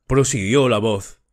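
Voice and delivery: usual speaking voice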